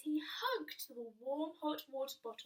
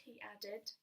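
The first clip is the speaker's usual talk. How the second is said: plain reading voice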